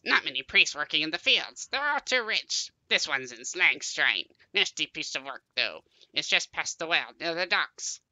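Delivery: grumbling creature farmer voice. Acted.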